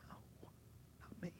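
barely audible, under the breath; hushed denial. Acted. Peter told he will deny Jesus, hushed. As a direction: whisper it as stunned Peter.

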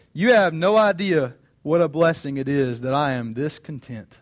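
ordinary talk

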